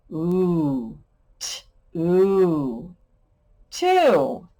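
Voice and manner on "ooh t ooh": "t, ooh" split apart slowly; sounding out t ooh. Shown as a demonstration. Teacher sounding out a word for kids: slow segmenting. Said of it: "this is".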